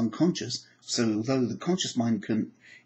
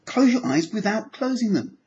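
higher, louder urging; urging harder; 8 semitones higher, 5 dB louder than usual. Shown as a demonstration. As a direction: push them to try really hard. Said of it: "Try really hard".

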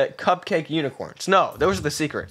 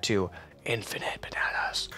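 whisper; 8 semitones lower, 11 dB quieter than usual. Real, not acted. Aside to himself.

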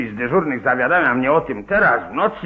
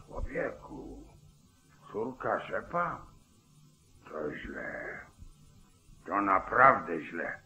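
barely voiced, much softer, slow, shaken; stunned whisper; 7 semitones lower, 8 dB quieter, slower than usual. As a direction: breathe it out in dismay. Sheriff hears the victim's name. (zszokowany szept)

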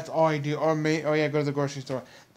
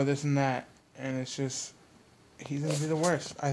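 ordinary delivery

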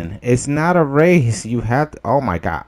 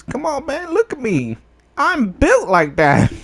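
high-pitched protest. Real, not acted. Player short of the required points, high-pitched protest. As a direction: voice jumps high in mock whining.

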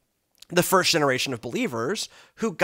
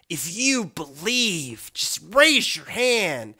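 altar call preacher; slower than usual. Retold or imitated.

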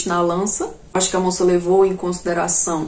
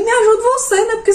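indignant retort; 12 semitones higher, 3 dB louder than usual. Retold or imitated. Retorting to the vendor.

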